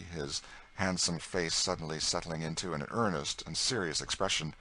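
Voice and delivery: ordinary delivery, nothing marked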